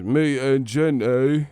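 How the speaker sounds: slow, drawn-out Gump drawl; mocking slow impression; [name] as Forrest Gump.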